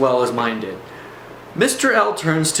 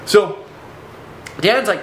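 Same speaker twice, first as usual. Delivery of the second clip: plain reading voice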